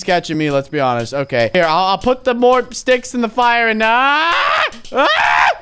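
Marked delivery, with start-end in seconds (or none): 3.8-5.5 startled scream. Real, not acted. Jump scare.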